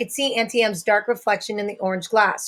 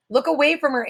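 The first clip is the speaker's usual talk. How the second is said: sharp, raised warning; shouted; 6 semitones higher, faster than usual. A narrator's reading. Annabeth warns the boys away from Medusa, a raised shout. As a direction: call out to the boys to look away. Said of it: "Annabeth shouted".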